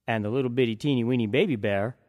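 ordinary delivery

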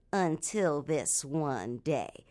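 slow, soft and ominous; slow suspense. A narrator's reading. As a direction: draw it out to build suspense. Suspense turn in story.

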